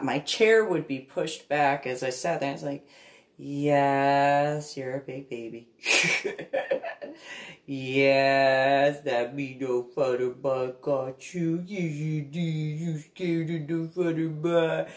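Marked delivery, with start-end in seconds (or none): 3.4-14.9 put-on baby talk. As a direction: soothe the frightened puppy. Comforting scared dog.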